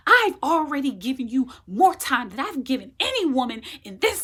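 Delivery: loud, high furious doctor yelling; irate doctor yelling at the patient. Retold or imitated. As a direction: shout him down in a rage. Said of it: "He's yelling".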